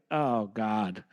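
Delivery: ordinary delivery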